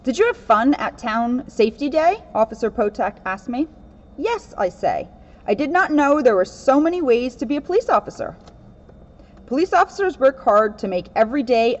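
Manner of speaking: steady storybook read-aloud voice; reading a picture book. Acted. Officer reading a children's book to kids, storybook voice.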